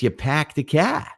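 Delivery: "park" as "pack", flat Boston vowels without r's; Boston accent. Shown as a demonstration. Comparing city accents, Boston accent. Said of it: "Boston, it's".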